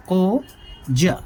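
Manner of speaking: कोजा drawn out very slowly, syllable by syllable; slow repeat. Shown as a demonstration. Teacher repeating the term slowly for students. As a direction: stretch the word so students catch it.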